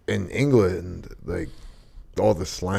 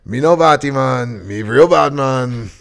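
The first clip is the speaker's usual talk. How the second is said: mock Jamaican patois, louder and higher; Jamaican accent; 6 semitones higher, 9 dB louder than usual. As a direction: do Trump in a hammed-up Jamaican accent. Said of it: "we do, like, the whole Jamaican accent".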